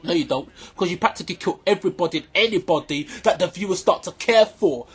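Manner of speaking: ordinary delivery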